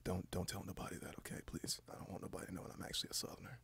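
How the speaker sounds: very hushed, secretive whisper; confiding whisper. Pretend. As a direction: drop to a whisper, as if sharing a secret.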